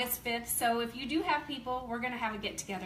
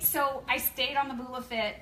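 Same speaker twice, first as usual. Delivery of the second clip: usual speaking voice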